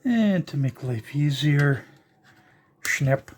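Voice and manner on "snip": ordinary talk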